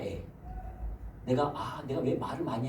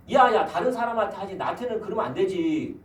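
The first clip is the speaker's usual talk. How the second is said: offended friend; 6 semitones higher, 6 dB louder than usual. Retold or imitated. Acted quarrel with offended friend.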